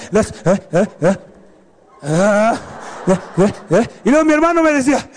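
usual speaking voice

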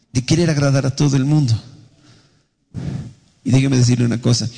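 ordinary delivery

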